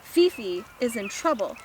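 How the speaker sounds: plain reading voice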